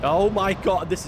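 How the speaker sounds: real shocked outburst; loud exclamation. Real, not acted. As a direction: blurt it in shock. Tornado wrecking the city.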